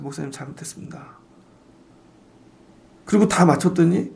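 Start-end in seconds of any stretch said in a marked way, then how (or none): 0.0-1.2 breathy whisper, almost voiceless; whispered apology. Retold or imitated. Apologizing to the pastor in a near whisper. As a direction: hush it like a sheepish confession.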